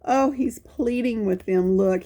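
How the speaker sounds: plain reading voice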